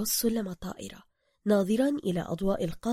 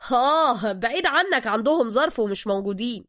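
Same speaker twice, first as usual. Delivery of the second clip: pushy Cairo driver; 3 semitones higher, 6 dB louder than usual. Acted. Driver character voice.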